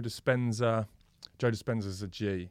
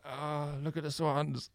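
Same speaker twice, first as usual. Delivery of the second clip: slow, dreamy fake calm; mock serene; 4 semitones higher, 4 dB quieter, slower than usual. Injured skater mock-admiring swans, slow fake calm. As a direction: sigh it out, pretending all is lovely.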